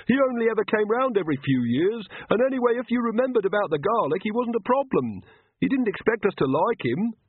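put-on a high, eager young villager, shrugging it off; villager Pyotr. A narrator's reading.

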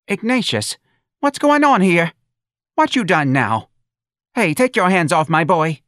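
high, loud scolding mother; protective mother. A narrator's reading. Mother pushing through the people to her son, high protective scolding. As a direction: pitch it high and loud, defending your boy.

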